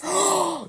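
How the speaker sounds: sudden shocked gasp; sharp gasp. Real, not acted. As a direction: gasp in realization. Realizes a plot hole.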